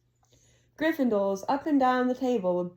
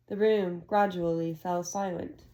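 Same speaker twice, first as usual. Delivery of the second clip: usual speaking voice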